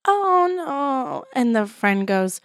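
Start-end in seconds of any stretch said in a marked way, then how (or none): 0.1-1.3 ad actress voice. Retold or imitated. Commercial woman quoted.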